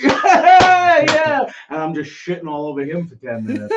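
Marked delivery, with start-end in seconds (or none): 0.0-1.5 very high, loud laughing yeahs; laughing buddy. Retold or imitated. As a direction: laugh along at your friend's roasting. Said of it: "his buddy ... is like".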